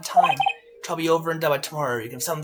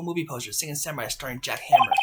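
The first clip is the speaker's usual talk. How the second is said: ordinary talk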